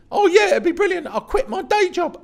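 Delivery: way up high, gushing; high eager squeal. Retold or imitated. Young poet accepting the tour, squealing high with eagerness. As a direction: squeal it like a starstruck kid.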